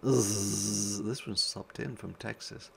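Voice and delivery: ordinary delivery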